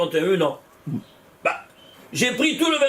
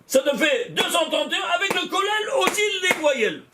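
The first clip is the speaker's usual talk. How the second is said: much higher, near shouted triumph; excited exclaiming; 9 semitones higher than usual. Real, not acted. Teacher revealing the vowels also give two thirty-one, high excited call. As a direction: crow the total out to the class. Teacher thrilled by another match.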